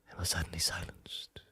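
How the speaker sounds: hushed dramatic whisper; very soft, voiceless, solemn delivery; Obi-Wan. Acted. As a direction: let it drop to an ominous whisper. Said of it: "cuts to Obi-Wan going".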